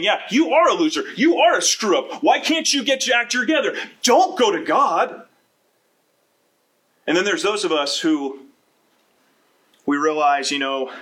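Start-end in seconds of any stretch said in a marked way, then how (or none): 0.0-5.3 higher, louder sneering accuser; a mocking Satan whispering blame, pitched up and loud; taunting Satan. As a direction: jeer at him like the devil in his ear. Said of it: "Satan's right there in our ear saying".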